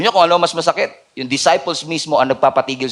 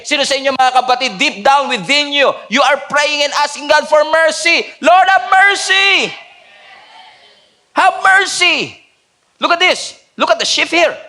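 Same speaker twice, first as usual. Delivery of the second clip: preaching at full force; 9 semitones higher, 3 dB louder than usual. Acted. Preacher urging the congregation, high loud impassioned plea.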